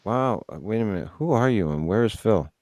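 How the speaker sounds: ordinary delivery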